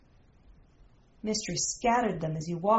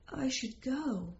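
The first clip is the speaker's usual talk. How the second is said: soft, slow near-whisper, slightly higher; 4 semitones higher, 7 dB quieter than usual. A narrator's reading. Lover reluctant to part, whispering softly. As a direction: murmur it slowly and very softly. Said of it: "he whispered".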